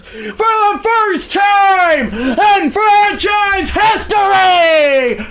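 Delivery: high, shouted, slow announcer call with a cry in it; crying out the title win on the mic; championship call. Acted. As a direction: scream the last out like the broadcaster of a lifetime. Calling the final out.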